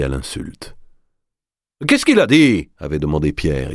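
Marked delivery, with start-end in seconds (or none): 1.9-2.7 hystérique. Acted. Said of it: "hystérique".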